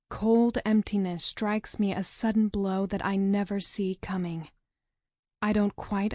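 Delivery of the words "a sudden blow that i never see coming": plain reading voice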